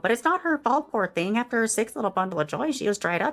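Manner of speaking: gossipy Karen. Acted. Karen gossiping about the neighbours' snacks, catty. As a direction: dish the dirt sweetly.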